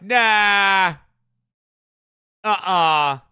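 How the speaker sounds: loud scoffing brush-off, imitating officials; mock dismissive nah. Host mocking the Smithsonian dismissing giants, loud scoffing. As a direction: wave it away loudly like a smug official. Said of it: "acting like".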